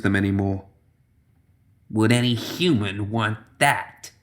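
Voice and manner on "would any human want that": soft, slow crone's rasp; quiet rasp. A narrator's reading. Old hag rasping quietly over the unconscious man. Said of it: "she rasped quietly".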